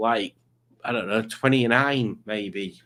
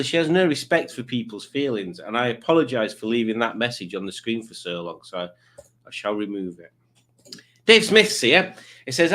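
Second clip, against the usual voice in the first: ordinary delivery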